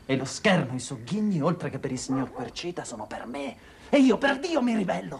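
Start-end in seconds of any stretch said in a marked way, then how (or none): 3.9-5.2 più alto e forte, in rivolta; grido di ribellione. Acted. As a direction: scaglialo come una sfida. Figlio si ribella alla madre. (grido di ribellione)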